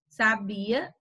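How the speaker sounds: sabia with stress on BI; middle-syllable stress. Shown as a demonstration. Teacher contrasts lookalike words, stressing BI in sabia. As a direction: lean on the BI in sabia. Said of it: "pra gente tá evitando aí a ambiguidade".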